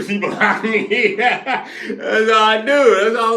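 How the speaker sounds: incredulous laughing shout; laughing disbelief. Real, not acted. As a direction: laugh it out stunned. Laughing at being blocked.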